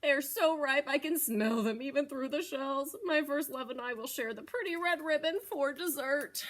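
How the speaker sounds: proud of his smelly gift; lightly voiced pleased goat; Mister Goat voice. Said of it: "said Mister Goat".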